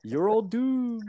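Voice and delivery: slow doom-laden warning, high; slow ominous raspy drawl. Retold or imitated. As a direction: croak out the prophecy slowly. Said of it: "he says his same old fucking line".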